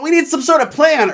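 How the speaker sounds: high, loud whine; mock whiny girl. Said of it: "she's like".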